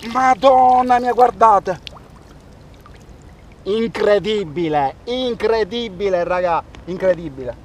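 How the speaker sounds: high, loud, slow amazed cries; breathless italian goal commentary. Real, not acted. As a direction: cry out each word in wonder. Amazed by the huge octopus. (telecronaca da gol senza fiato)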